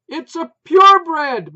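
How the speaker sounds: very loud, high, slow; evasive shout. A narrator's reading. Wife dodging the price question, loud evasive shout. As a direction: dodge it at full volume. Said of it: "Maureen evaded at volume".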